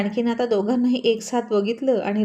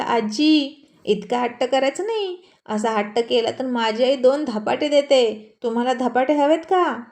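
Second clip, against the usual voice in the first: a child playing the stern parent; small boy scolding; 2 semitones higher than usual. Little Rana scolds stubborn grandma for not eating, mock stern boy.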